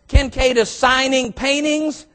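ordinary delivery

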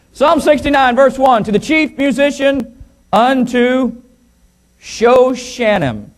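high, projected pulpit reading; scripture reading. Real, not acted. Preacher announcing psalm to congregation, scripture reading.

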